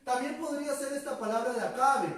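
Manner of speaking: ordinary talk, nothing marked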